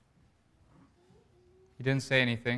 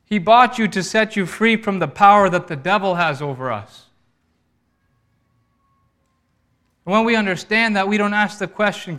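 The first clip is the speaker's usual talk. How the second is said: loud, high, forceful sermon delivery; ringing, emphatic pulpit proclamation; impassioned preaching; 6 semitones higher, 8 dB louder than usual. Real, not acted. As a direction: preach it with full conviction.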